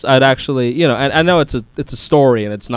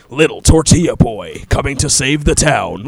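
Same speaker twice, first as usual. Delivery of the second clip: slow, imitation trailer narrator; movie guy voice; 3 semitones lower, slower than usual. Retelling the Pablo Francisco joke, movie guy voice. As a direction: draw it out like a movie-trailer announcer. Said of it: "with the movie guy voice".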